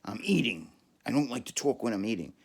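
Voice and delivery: loud, fast and offended; cranky eater yelling back. Retold or imitated. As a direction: snap it like you're interrupted mid-bite. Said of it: "she yells at me really loud".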